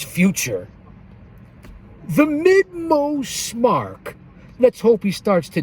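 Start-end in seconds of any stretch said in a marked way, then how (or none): 2.1-4.1 much higher, slow drawn-out ring announcer call; hype announcer call. Acted. Host giving a shout-out to a friend, announcer style. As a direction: stretch the name like a ring announcer.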